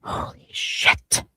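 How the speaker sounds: slow, breathless whisper of awe; awed whispered disbelief. Real, not acted. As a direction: breathe it out slowly, stunned.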